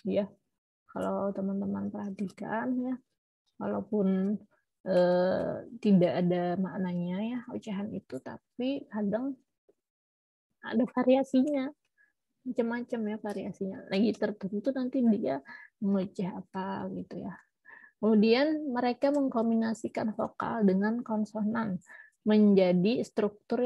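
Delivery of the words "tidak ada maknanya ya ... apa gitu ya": normal talking voice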